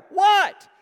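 very high, loud shout back; mimicked child's loud retort; yelled reply. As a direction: imitate a kid hollering back at his brother. Brother answers the yelled calls.

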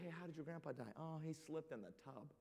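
hushed grandkids. Retold or imitated. Grandkids ask about boring death.

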